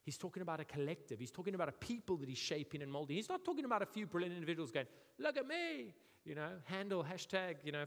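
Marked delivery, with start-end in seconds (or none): 5.2-5.9 much higher look-at-me call; show-off. Retold or imitated. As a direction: preen for attention. Mocking show-off individuals.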